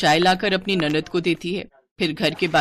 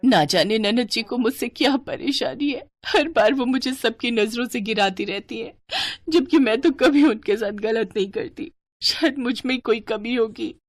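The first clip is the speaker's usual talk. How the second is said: sobbing, wondering to oneself; tearful self-blame; 3 semitones higher than usual. Acted. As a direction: think aloud through tears, hurt and bewildered. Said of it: "बेचारी अलका वहीं बैठकर खूब रोती है". (रोते हुए, मन ही मन दुखी)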